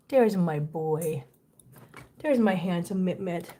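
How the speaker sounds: low, slow tender coo; doting. Real, not acted. Owner greeting her handsome boy, doting. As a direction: greet an adored pet lovingly.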